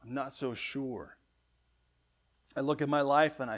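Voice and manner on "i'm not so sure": slow, much softer, unsure; hesitant believer trailing off quietly; question-mark doubt. Shown as a demonstration. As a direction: let the doubt hang in the voice. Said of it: "have a question mark".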